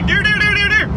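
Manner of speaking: urgent deer warning. Retold or imitated. Husband defending his deer warning, urgent calling. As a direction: call it out to warn the driver. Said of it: "I was communicating very clearly".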